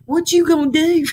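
ordinary talk, nothing marked

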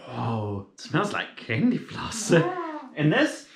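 normal talking voice

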